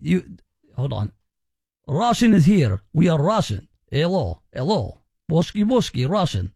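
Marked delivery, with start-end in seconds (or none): 1.9-6.5 hammed-up Russian accent; mock Russian accent. Shown as a demonstration. Host finding his way into a German accent, mock Russian accent. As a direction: warm up with a silly Russian accent.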